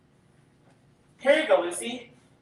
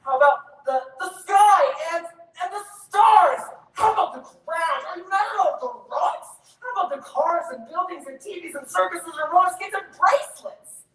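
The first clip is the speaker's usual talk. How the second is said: rising, louder and higher list of questions; escalating questions; 6 semitones higher, 3 dB louder than usual. Acted. Brother quizzing his sister about everything, escalating loud questions. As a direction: pile it on, almost shouting.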